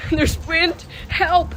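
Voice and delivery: high, loud fake cry for help; mock panic. Streamer hamming up fear of the wind outside, high mock cry. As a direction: yelp as if the wind were attacking.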